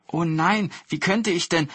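lightly flustered, humble woodcutter; modest protest. A narrator's reading. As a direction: politely decline in surprise. Woodcutter modestly refuses the gift.

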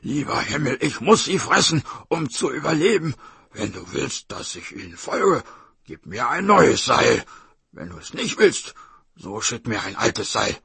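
tiger cursing loudly, then pleading; forceful tiger voice with swinging pitch; angry, desperate tiger. Acted. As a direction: curse and beg heaven as a hungry tiger. Narrator voices angry tiger praying.

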